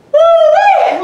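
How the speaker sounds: high, loud playful "woo-wee"; excited whoop. Real, not acted. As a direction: whoop it out with glee. Coach cheering mid-workout.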